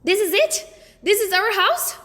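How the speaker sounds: high, loud incredulous questions; can't believe this is the house; dismayed disbelief. A narrator's reading. Peter dismayed at new house.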